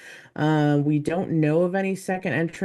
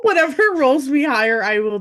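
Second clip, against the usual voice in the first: excited; 9 semitones higher, 7 dB louder than usual. Real, not acted. Excited about dice roll.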